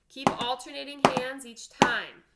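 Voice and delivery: normal talking voice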